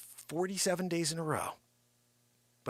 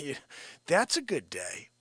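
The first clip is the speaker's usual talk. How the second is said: normal talking voice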